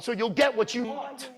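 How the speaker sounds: ordinary delivery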